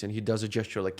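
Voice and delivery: ordinary delivery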